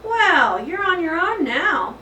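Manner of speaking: teacher frowning down at hamster, stern; gruff teacher. Acted. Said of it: "she said".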